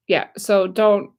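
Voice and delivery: ordinary talk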